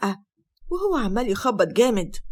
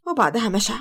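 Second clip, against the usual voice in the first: usual speaking voice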